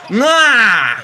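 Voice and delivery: loud high yell of triumph; a short celebratory yell; triumphant shout. Real, not acted. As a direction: bark it out as the shot drops. Big play in game.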